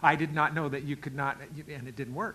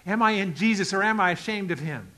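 usual speaking voice

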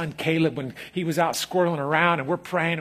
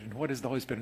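normal talking voice

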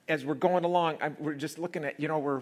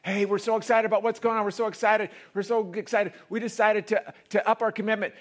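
excited texter; 10 semitones higher, 10 dB louder than usual. Retold or imitated. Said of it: "I get a text".

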